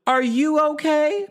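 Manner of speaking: high, slow, drawn-out tagline delivery; theatrical high-pitched question; sassy tagline. Acted. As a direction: stretch it out like a show-opening tagline. Repeats tagline as performance.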